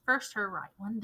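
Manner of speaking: usual speaking voice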